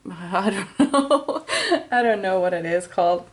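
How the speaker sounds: laughing it off. Real, not acted. Tarot reader laughing at her own grammar. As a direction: chuckle through the words.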